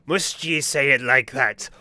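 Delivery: slow, hammed-up pirate voice; mocking the pirate. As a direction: drag the words out like a swaggering pirate. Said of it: "He do be talking like that".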